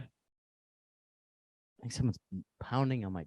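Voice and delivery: very soft and slow; alarmed hush. Real, not acted. Hears someone pounding on door.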